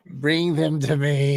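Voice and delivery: slow, menacing emperor voice; cold rasping evil emperor. Retold or imitated. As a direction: rasp it out like a tyrant. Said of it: "my Liam impersonation".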